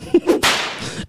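breathless unvoiced laugh, high; silent wheeze laugh. Real, not acted. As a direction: laugh without voice. Laughing at sound effect.

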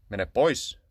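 loud, firm demand to go away. A narrator's reading. Murhinen demanding that the cat go away. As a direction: order him off sharply. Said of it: "Murhinen vaati".

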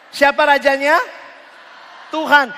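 ordinary delivery

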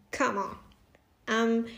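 usual speaking voice

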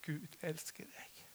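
slow, hushed whisper; re-enacted message, barely voiced. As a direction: whisper it slowly like a tender revelation. Retold personal prophecy over him.

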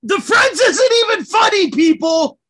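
loud, high indignant shout. Real, not acted. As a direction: yell it out in outrage. Ranting about Friends.

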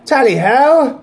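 cheery upper-class tally ho; British accent. Acted. Mock posh British bit.

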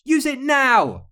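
very high, loud cry; shrill-pitched storybook battle cry; urgent shout. A narrator's reading. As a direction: yell the order to a comrade. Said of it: "shouted Maldive".

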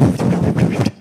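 loud rushing blast of wind noise; imitated wind roar. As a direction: blow a loud, voiceless gust. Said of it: "it's all like".

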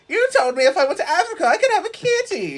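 put-on very high, fast, loud kid voice; hammed-up squealing brat; whining child. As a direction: protest like a spoiled child. Mock child whining for kitty.

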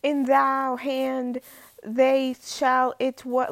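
mocking the teacher's slow dramatic reading; mock Shakespeare reading. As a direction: mangle the thees and thous like a bad stage actor. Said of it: "The fucking passage he just reads me is like".

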